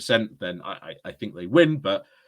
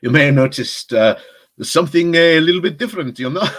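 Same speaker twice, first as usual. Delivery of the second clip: a bad, hammed-up French accent, louder than usual; fake French accent; 3 semitones higher, 10 dB louder than usual. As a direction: put on a cheesy French accent as a joke greeting. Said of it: "That's not even a French accent".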